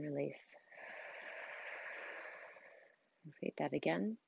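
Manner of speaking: soft, unhurried meditation voice with long pauses; guided meditation. Acted. Coach leads listeners through breathing and visualizing their dream life, guided meditation. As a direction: guide them gently through breathing and imagining, softly.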